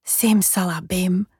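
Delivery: slow, drawn-out incantation; magic spell. Acted. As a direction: stretch out the magic word. Sheep casts a vanishing spell.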